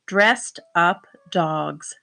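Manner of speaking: put-on each word read out slowly for kids; slow reading aloud. Shown as a demonstration. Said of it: "That's the title".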